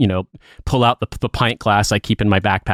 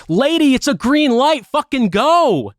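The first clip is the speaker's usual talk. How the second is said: high, drawn-out exasperated road yell; indignant traffic shout, pitched way up; retold yell; 14 semitones higher, slower than usual. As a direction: holler it slowly at a dawdling cyclist. Yelling at a hesitating cyclist, retold.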